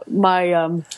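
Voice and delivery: usual speaking voice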